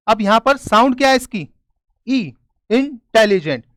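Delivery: ordinary talk, nothing marked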